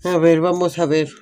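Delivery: normal talking voice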